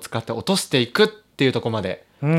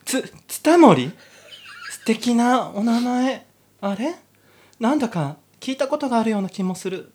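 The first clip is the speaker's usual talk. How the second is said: put-on higher, girlish heroine voice, dreamy then gushing; giddy heroine; 9 semitones higher than usual. Heroine swooning over the soldier, giddy high voice. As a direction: play the lovestruck girl.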